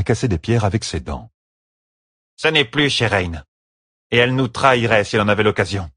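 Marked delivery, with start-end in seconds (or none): 2.4-5.9 stern Tesline. A narrator's reading. Tesline warns, stony woman.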